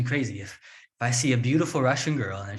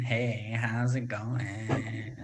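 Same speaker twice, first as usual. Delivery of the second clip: slow, dragged mock Valley girl fry; vocal fry; 5 dB quieter, much slower than usual. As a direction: drag it out in a lazy creaky mock fry. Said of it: "the Kim Kardashian".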